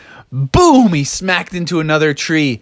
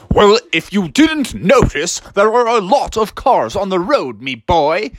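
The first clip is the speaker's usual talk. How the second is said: higher put-on father voice; storybook dad; 6 semitones higher than usual. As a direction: answer a whining son in a character voice. Froggy's dad answers him.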